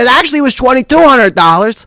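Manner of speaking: high, loud retort; heated correction. Retold or imitated. Answering back in retold quarrel.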